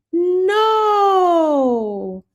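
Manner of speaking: high emotional shout of no; a sudden overwhelmed no; real cry of surprise. Real, not acted. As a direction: cry out in shocked delight. Sees former student in chat.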